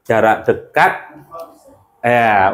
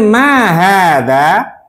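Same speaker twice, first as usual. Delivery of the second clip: "mahada" drawn out, rising high at the end; question intonation; 6 semitones higher, much slower than usual. Shown as a demonstration. Teacher models for students to repeat, question intonation on "mahada". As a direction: read "mahada" slowly as a lifted question. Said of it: "Pakai intonasi pertanyaan".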